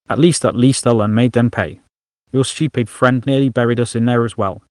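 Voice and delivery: ordinary delivery, nothing marked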